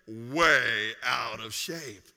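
way stretched slow and low; slow, low, emphatic confession; drawn-out emphasis. Real, not acted. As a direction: lean hard into way, draw it out. Confesses being unfit.

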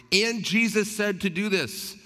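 high, drawn-out mock inner cry; acted-out exclamation, pitched high and stretched; voiced inner outburst. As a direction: voice the loud thought behind polite listening. Inner thought voiced against calm listening.

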